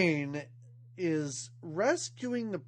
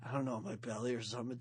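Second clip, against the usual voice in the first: low, soft tough-guy voice; Snake impression; 7 semitones lower, 9 dB quieter, much faster than usual. Tough prisoner asking tattoo artist for snake, low and soft. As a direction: drop the voice low and quiet like a hard man. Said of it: "Amazing snake imitation".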